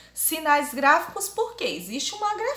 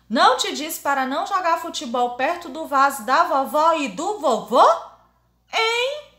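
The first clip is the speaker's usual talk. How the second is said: put-on higher-pitched cross mum telling off her child; scolding mother; 4 semitones higher, 5 dB louder than usual. Mãe dizendo pro menino que não jogue futebol perto do vaso, brava. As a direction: scold with a sharp questioning lift at the end. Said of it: "ela tá com a carona de brava".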